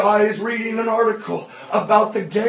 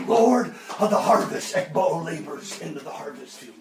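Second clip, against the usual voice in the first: low, fading prayer; hushed, low prayer; soft prayer; 7 semitones lower, 6 dB quieter than usual. Retold or imitated. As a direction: let the prayer sink down softly. Girl's prayer quoted softly.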